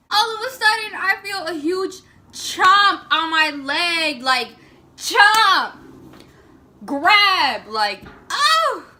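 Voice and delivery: high, loud, startled retelling; retold panic. Retelling the dolphin chomping her leg, startled and loud. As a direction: relive the sudden bite in a rush.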